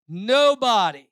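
nobody drawn out slowly and loud; emphatic nobody. Acted. Preacher voicing the Rechabites' refusal, drawn-out loud nobody. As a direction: land the word nobody like a verdict.

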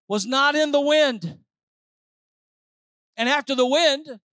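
rising Pentecostal preaching. Acted. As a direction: preach it to the rafters. Preaching at full force.

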